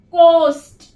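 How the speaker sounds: Most said very slowly, sound by sound; most stretched into its separate sounds. Shown as a demonstration. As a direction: draw out most slowly for learners. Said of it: "जैसे word है most".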